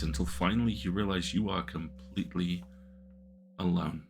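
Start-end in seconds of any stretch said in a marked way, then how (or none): none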